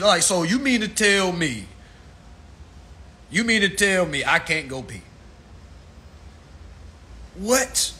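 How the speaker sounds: normal talking voice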